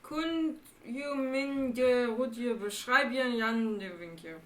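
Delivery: slow, halting read of foreign words; careful slow reading. Real, not acted. Player reading Dutch into a translation app, careful and slow. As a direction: sound out the Dutch slowly.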